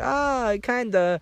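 high, sheepish mock voice; imitating cruise worker. Cruise worker admitting she's lost, high sheepish mock. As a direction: admit it with a squeaky shrug. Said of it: "She's like".

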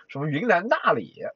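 ordinary talk

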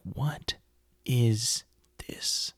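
dropped to a near-hush, drawn out; hushed what is this. Retold or imitated. Kid watching the cornfield scene, hushed and uneasy. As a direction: breathe the question out in awe, barely voiced. Viewer reacting to eerie scene.

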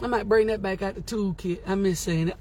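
ordinary talk

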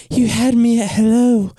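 high, breathy lovestruck woman; best female voice. Acted. Dorothy answers Jerry, a high tender female voice. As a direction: sigh it like a smitten woman. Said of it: "let me see if I can do this voice".

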